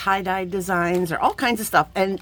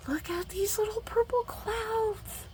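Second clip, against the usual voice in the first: much higher, softer, slow coo; cooing delight; 13 semitones higher, 6 dB quieter, slower than usual. Real, not acted. Showing viewers the beads, soft high coo. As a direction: marvel softly at the purple beads.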